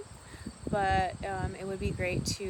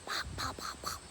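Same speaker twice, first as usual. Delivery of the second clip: imitating duck pops; 6 dB quieter than usual. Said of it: "'cause he goes".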